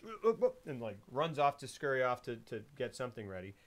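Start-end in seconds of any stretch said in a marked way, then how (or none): 0.0-0.5 high little startled grunts; flustered noises. Retold or imitated. Acting her scurrying off to get ready, high flustered noises. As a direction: mimic a hurried, flustered "uh, uh, uh". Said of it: "And she goes".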